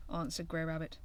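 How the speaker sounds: usual speaking voice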